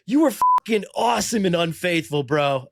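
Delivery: high-pitched fan shout, re-enacted; retold drunken holler. Drunk fan praising an actor from the front row, mock holler. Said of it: "I'm, like, blackout drunk".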